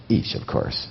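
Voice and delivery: hushed, breathless aside to himself; whispered inner thought. Retold or imitated. Realizing the price is for each ticket: a whispered thought. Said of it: "I'm thinking to myself".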